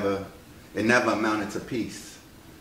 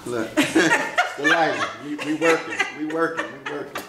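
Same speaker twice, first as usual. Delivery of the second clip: laughing; 7 semitones higher than usual. Real, not acted. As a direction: chuckle through it. Laughing about the heat.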